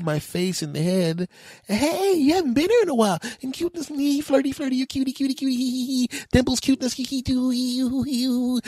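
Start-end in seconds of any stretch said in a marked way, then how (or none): 1.7-3.2 high, gushing mock flirt; flirty greeting. Cashier gushing over a returning customer, flirty. 3.4-8.6 high sing-song mock flirty babble; cutesy flirting. Mocking the flirty cashier, cutesy. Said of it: "that she's flirting".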